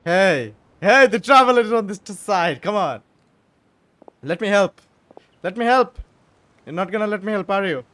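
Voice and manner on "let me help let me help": usual speaking voice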